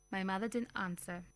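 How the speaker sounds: ordinary delivery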